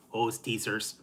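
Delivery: usual speaking voice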